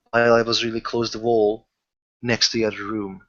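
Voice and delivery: ordinary talk, nothing marked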